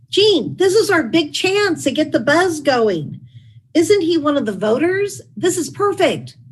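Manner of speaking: hammed-up enthusiasm, pitch way up; eager excitement. Bush eager to push idea.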